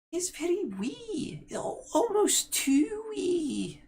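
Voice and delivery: squeaky Scottish hag, slow and drawn out; Scottish crone. Acted. Crone reports to her sister about the boy, high Scottish voice. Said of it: "did you become Scottish".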